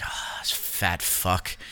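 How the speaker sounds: slow, low, contemptuous whisper; judging whisper. Retold or imitated. Felix judging the cook's food spread, slow low whisper. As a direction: breathe it out with slow disdain.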